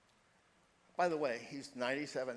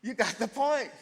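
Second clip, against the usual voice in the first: much higher and louder, triumphant; emphatic loud repeat; 10 semitones higher, 11 dB louder than usual. Acted. Preacher repeating to Sally, loud and triumphant. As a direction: drive the point home the second time. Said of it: "That's perfect".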